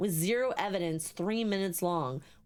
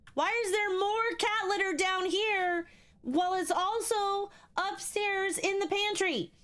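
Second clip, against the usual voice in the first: high-pitched, indignant complaining; exasperated rant; 8 semitones higher than usual. Real, not acted. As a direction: whine each question up high, fed up. Complaining about game's objects.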